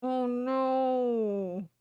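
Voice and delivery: dismayed character. Acted.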